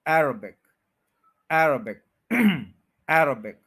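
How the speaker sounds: अरेबिक as "AIR-uh-bik", AIR punched; stress on first syllable. Shown as a demonstration. Teacher drilling Arabic, AIR stressed first. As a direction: hit AIR first, then a light uh-bik. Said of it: "first पे stress है".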